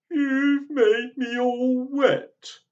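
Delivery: bawled. Acted. Little boy soaked by granny, high loud slow bawling. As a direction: wail it like a crying child. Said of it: "he bawled".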